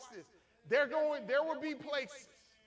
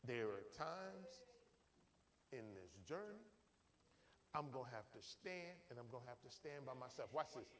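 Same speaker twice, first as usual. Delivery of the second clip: hushed preaching; 11 semitones lower, 12 dB quieter than usual. Acted. Preacher quietly telling the congregation they must stand alone. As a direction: bring it down low and intimate. Sermon drops to low intimate point.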